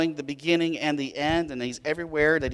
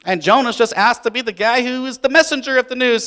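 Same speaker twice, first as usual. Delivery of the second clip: normal talking voice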